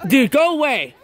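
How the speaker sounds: high, exasperated brush-off; annoyed dismissal. Real, not acted. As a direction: shoo away a pestering friend. Sends off friend who startled him.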